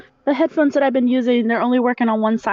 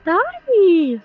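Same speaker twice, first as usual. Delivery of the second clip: excited greeting; 9 semitones higher, 4 dB louder than usual. Real, not acted. Cheerful greeting.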